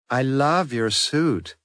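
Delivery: falsely sweet American Yankee twang. A narrator's reading. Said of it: "in a not unpleasing Yankee twang".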